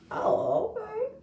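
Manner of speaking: high, breathy dismissive okay; mock okay. Imitating the girl cutting the argument short. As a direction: brush her off with a high little okay.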